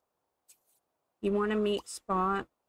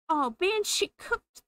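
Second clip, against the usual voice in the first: much higher, startled cry of dismay; dismayed surprise; 11 semitones higher than usual. Real, not acted. Player shocked her sim cooked the pet fish, high dismayed cry. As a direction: blurt it out in shock, pitch jumping up.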